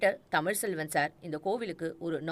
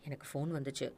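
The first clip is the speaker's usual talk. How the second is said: much softer, subdued admission; low-voiced; 4 semitones lower, 9 dB quieter, faster than usual. Captive finally confessing to his captor, softly. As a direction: say it barely above a murmur. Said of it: "மெதுவான குரலில் சொல்லத் தொடங்கினான்".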